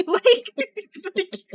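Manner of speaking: laughing. Real, not acted. Laughing while ranting about casting.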